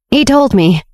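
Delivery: a touch of agitation; getting worked up. A narrator's reading. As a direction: start to get heated. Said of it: "starting to get worked up".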